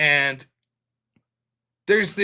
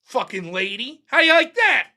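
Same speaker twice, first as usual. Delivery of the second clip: higher, mock triumphant taunt; gloating taunt; 6 semitones higher, 4 dB louder, slower than usual. Speaker taunting the rude boss, higher mock gloating. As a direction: rub it in at the lady. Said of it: "I was like".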